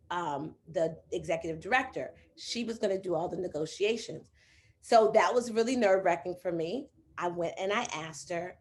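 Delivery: usual speaking voice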